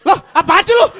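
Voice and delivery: loud annoyed retort; irritated. Acted. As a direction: snap back at the stranger. Annoyed reply at red light.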